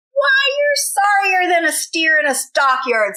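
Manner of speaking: mocking taunt. Stepbrother jeering at tired Bubba, mocking. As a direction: sneer it at him. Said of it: "said Dwayne".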